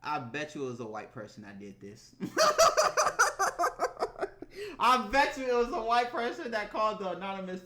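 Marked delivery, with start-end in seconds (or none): 0.0-2.1 low, quiet remark under the breath; hushed, low-pitched aside; low muttered aside. Real, not acted. Deadpan aside before bursting out laughing. 2.2-4.7 high, loud cackling laugh; real high-pitched belly laugh; big laugh. Real, not acted. Cracks up after own remark.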